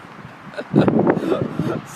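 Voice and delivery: high burst of laughter; loud laugh. Real, not acted. Laughs after joke.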